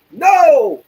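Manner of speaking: loud, very high acted shout; emphatic denial. Customer denying the motorcycle purchase, outraged shout.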